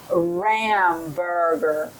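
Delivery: "ramburger" said slowly, sound by sound; teacher's slow model word; slow modelled word. Shown as a demonstration. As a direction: draw out "ramburger" for the child. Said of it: "you have 'em do it with you".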